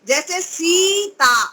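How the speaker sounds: सीता said slowly with a long सी; long vowel shown. Shown as a demonstration. Teacher demonstrating the long vowel slowly. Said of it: "दीर्घ ई की मात्रा है".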